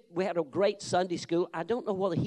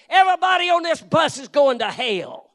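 loud, higher hellfire shout at the passengers; bus preacher yelling; 10 semitones higher, 11 dB louder than usual. Retold or imitated. Zealous street preacher shouting at bus riders, loud and high. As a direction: bellow it at a busload of strangers. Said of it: "he'd just step up on a bus and say".

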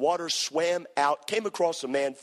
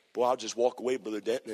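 normal talking voice

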